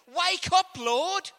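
high, loud demand to wake; David pleading for God to wake; urgent plea. Acted. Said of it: "This is what David is doing".

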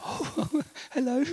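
high, flustered surprised hello; startled greeting. Retold or imitated. Man answering a call from a famous missionary, startled. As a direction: squeak it out caught off guard. Caught off guard by famous caller.

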